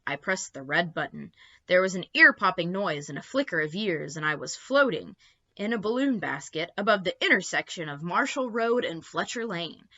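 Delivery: usual speaking voice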